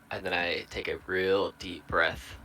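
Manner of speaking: usual speaking voice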